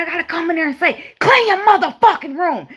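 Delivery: high, loud mock yell of mama. Mama yelling at the kids to clean their room. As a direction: bark the order like an angry mama. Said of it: "She said".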